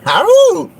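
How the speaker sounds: sharp high-pitched "au" yelp. Acted. Bragging to viewers, then a high yelp.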